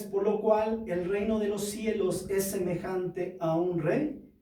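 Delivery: ordinary talk, nothing marked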